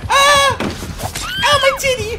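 very high, loud wailed apology; mock-distraught screaming. Player failing to save Kevin, despairing shrieks.

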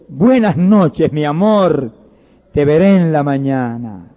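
slow, lingering bedtime goodbye; tender farewell. Acted. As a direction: say goodnight slowly and tenderly, voice sinking. Mother's tender farewell to her son.

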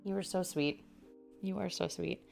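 ordinary delivery

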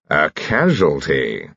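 slow, drawn-out, knowing announcement; arch. Acted. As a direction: draw it out with playful self-importance. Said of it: "he said archly".

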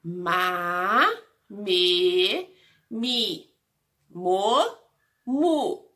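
"Ma, me, mi, mo, mu" as separate drawn-out syllables; syllable drill. Shown as a demonstration. Teacher reciting the syllables of letter M, "Ma, me, mi, mo, mu" one by one. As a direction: stretch and separate "Ma, me, mi, mo, mu". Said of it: "pontilhados das sílabas".

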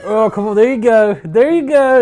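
loud, high mock effort; hammed-up struggling coaxing; straining actor. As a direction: push it out as if straining to move a stiff face. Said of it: "He's like".

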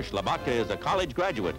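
normal talking voice

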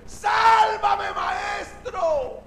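high, long-held shout; desperate cry for help. Acted. Drowning Pedro to his master, acted desperate shout. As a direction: cry out to be saved, stretching the words high. Sinking Pedro calls for rescue.

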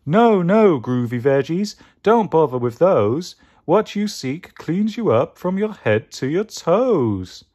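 high, loud groovy guru; Mystic Mango. Acted. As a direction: chant a riddle like a hippie mystic.

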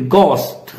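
"ghost" as gohst with a hard g; correct GHOST. Shown as a demonstration. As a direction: repeat "ghost" with no breath after g. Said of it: "not ghost".

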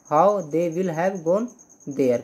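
dictating word by word, slowly; slow dictation. Shown as a demonstration. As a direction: spell the sentence out slowly for students. (धीमे-धीमे, शब्द-शब्द बोलकर लिखवाना)

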